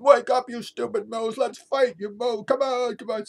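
put-on high, loud little-girl holler; imitated yelling. Niece on his back yells at moose, shouting. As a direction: yell high and hard, goading a fight. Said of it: "she's yelling".

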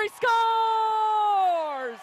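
drawn-out shouted goal call; long goal yell. Real, not acted. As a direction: hold the last word long and loud.

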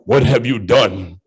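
low, slow accusing mother; stern mama. Retold or imitated. Mama questioning the kids, low and slow. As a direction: drag it out low like a mother who knows.

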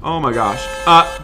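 ordinary delivery

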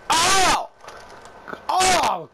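loud high surprised oh; excited shout. Real, not acted. Gamer yelling at a huge play in the game, excited shout. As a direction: yell at the big play.

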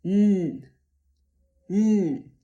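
Mm as a firm, buzzing "nnn"; vibrating nasal N. Shown as a demonstration. Teacher has learners feel the vibration, firm nasal hum. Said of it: "Poczujcie wibrację".

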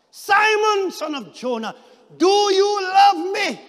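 high, loud, slow plea; shouted third question. Acted. Jesus asking Peter a third time, loud slow shout.

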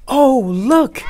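high, loud eager shout; excited kids. A narrator's reading. Class excited.

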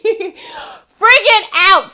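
laughing loudly and high, slowly. Real, not acted. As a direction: burst out laughing at your own drama. Laughing retelling mall meltdown.

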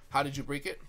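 ordinary talk, nothing marked

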